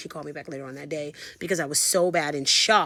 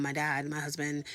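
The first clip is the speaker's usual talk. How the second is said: usual speaking voice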